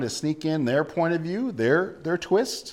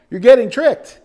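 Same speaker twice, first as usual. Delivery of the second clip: laughing retort; 8 semitones higher, 13 dB louder than usual. Retold or imitated. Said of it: "he's like".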